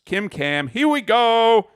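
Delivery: drawn-out high excited announce; hype intro call. Real, not acted. Host opening the next review, excited call. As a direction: kick off the segment with a big call.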